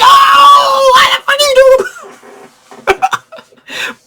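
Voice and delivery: high, cracking mock cry of outrage; shocked outcry. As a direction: wail it out in disbelief. Shocked reaction to betrayal.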